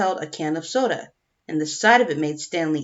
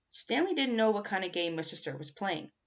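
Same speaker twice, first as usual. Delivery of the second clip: usual speaking voice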